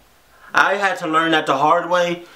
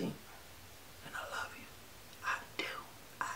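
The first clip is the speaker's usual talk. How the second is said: whispered soft goodbye; 26 dB quieter than usual. Real, not acted. Vlogger whispering love to viewers at the end. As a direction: breathe it out like a secret.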